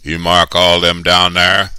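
workman's question. A narrator's reading. One workman asks the boss about trees, calling out loudly. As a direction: call it out loud and slow.

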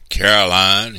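loud, threatening call of a name; stern, forceful and menacing. A narrator's reading. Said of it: "his voice low and menacing".

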